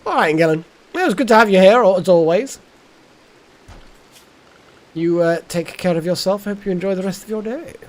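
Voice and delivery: loud, high posh farewell; plummy upper-class send-off. Acted. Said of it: "I'm going to say it in a posh voice".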